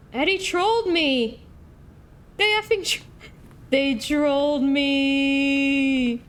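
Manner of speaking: high, loud protest with the last words stretched out; outraged wail. Real, not acted. Streamer protesting that Eddie trolled them, in an outraged high wail.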